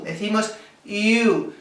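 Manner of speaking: "you" said the English way, with a Y glide. Shown as a demonstration. Teacher models "you" for Spanish students, slowly. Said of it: "¿Cómo decimos tú?".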